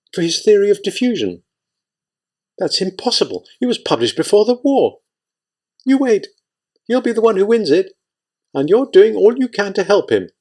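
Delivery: a hint of a wife needling her husband; Lyudmila arguing. A narrator's reading. Narrator voices Lyudmila arguing.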